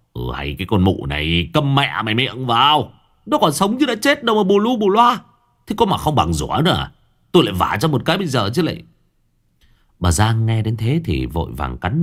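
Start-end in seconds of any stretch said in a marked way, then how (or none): 0.1-8.8 angry rebuke, climbing higher in pitch; bullying scolding. A narrator's reading. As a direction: snap at your wife to shut up. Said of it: "Ông Đức quay sang nạt nộ". (nạt nộ, quát mắng vợ)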